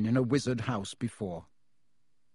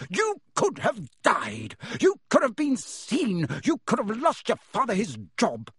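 furious scolding; 8 semitones higher than usual. A narrator's reading. Mother continues yelling at sons.

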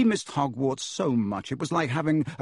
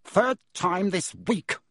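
bellowed across the table, slow; furious uncle roaring at breakfast; angry roar; 7 semitones higher, slower than usual. Acted. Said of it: "he roared across the table".

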